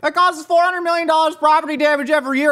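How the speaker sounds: high-pitched imitation of a shouting onlooker; mock alarmed yelling. Onlookers read damage figures off phone, mock alarmed yelling. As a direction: call it out in exaggerated alarm.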